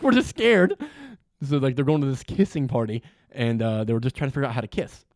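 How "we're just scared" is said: put-on high, loud timid kid voice; mock scared kid. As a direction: act a scared kid, overdone. Mocking kids' fear.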